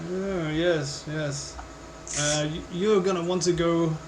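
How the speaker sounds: lightly voiced barkeep with a vague accent; a hint of an indefinable accent, hesitant; Harry John. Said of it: "I don't even know what this accent is now".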